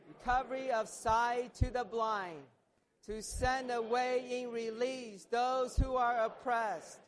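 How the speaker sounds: loud, ringing group-led proclamation; proclaiming scripture. Real, not acted. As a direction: proclaim it boldly to the whole congregation. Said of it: "proclaim this".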